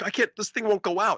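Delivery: high, mock-frantic complaint; imitated flustered friend. Retelling friend kicking dirt on the fire, mock frantic. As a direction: act out a helpless, fretting friend.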